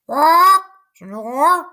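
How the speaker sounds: very high, loud squawk rising. A narrator's reading. Toucan calling the sleeping man, loud squawk. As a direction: squawk it like a bird. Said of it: "squawked the toucan".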